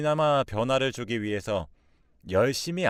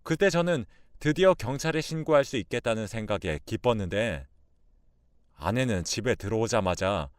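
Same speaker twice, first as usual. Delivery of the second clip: plain reading voice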